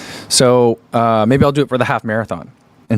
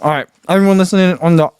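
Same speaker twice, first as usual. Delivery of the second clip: talking with a mouth full; words round a bite of brownie; 5 semitones higher, faster than usual. Real, not acted. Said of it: "sorry, I'm talking with my mouth full".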